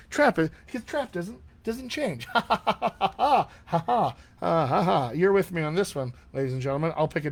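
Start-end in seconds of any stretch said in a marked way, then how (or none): 2.3-5.1 high, rolling burst of laughter; real laughter. Real, not acted. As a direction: laugh heartily at your own slip. Laughing at own badly chosen word.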